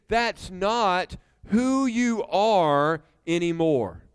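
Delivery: very slow, weighty delivery; deliberate emphasis. Real, not acted. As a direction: let each word land separately. Preacher drives in his main point.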